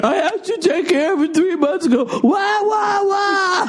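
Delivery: high, wailing fake sob; blubbering hammed-up rant about the house; mock crying husband. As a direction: ham up his tantrum, crying. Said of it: "let's do a little role playing".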